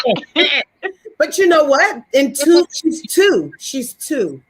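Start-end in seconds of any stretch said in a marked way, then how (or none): 0.1-0.9 higher, sing-song uh-uh; playful copy of refusal. Retold or imitated. Host copying the mother's refusal playfully. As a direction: mimic her playfully. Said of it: "Was it".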